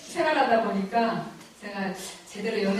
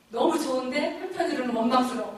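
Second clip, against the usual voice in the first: normal talking voice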